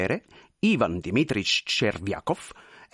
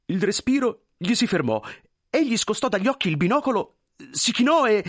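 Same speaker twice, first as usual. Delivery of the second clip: rising higher and louder toward the sneeze; building suspense; 10 semitones higher, 5 dB louder than usual. A narrator's reading. Narrator building to the clerk's sneeze, rising and louder. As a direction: wind it up like a sneeze is coming.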